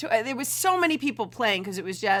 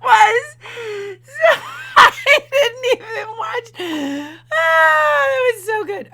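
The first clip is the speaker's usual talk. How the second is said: high, loud laughing cries; losing it completely; howling laughter; 13 semitones higher, 8 dB louder, slower than usual. Real, not acted. Host reacting to the clip howls with laughter.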